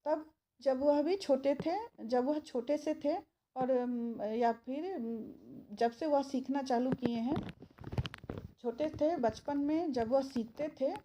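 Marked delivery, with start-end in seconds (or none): none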